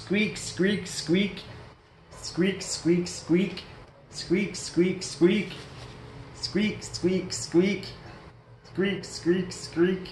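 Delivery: high, soft piglet squeaks. Acted.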